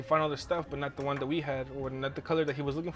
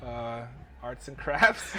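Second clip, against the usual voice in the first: slow, lowered, unsure mock answer; hesitant excuse; 4 semitones lower, much slower than usual. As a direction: drag out a lame excuse. Retold lame excuse to the officer.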